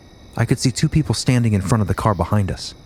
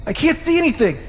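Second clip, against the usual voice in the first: much higher frightened shriek; panicked scream. Retold or imitated. Woman blinded, screaming out to her husband in panic. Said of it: "I'm screaming out to my husband".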